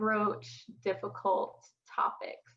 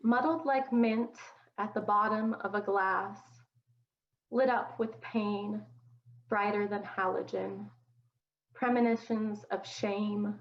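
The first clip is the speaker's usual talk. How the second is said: poetry reading. Acted. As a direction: read each line of the poem deliberately, letting it settle. Poet reads her poem aloud.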